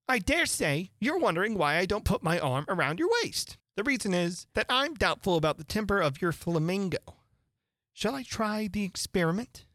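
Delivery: put-on moralizing old noblewoman, sing-song; fussy Duchess voice. A narrator's reading. Duchess voiced in read story.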